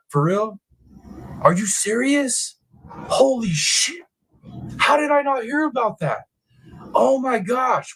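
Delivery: high, slow, drawn-out disbelief; can't believe what you just read; stunned disbelief. Real, not acted. Streamer reacting to chat's arrest news, slow stunned disbelief.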